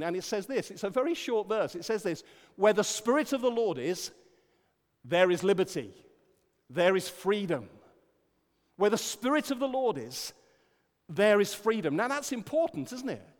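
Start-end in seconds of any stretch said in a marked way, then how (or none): 2.5-7.7 loud, ringing reading of the verse; scripture reading. Acted. Preacher reading scripture to the congregation, ringing. 8.8-11.9 high, loud proclaiming of the verse; preaching. Acted. Preacher repeating the verse to the church, proclaiming.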